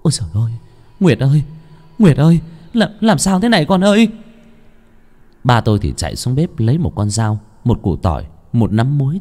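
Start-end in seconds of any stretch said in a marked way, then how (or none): 0.0-4.1 panicked mother crying out louder and higher; mother calling her convulsing daughter, frantic and loud. A narrator's reading. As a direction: call her daughter's name in rising panic. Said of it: "luôn miệng gọi".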